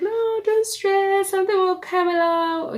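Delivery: a hint of a soothing husband; mild mock reassurance from her husband; husband reassuring. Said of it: "My husband's like".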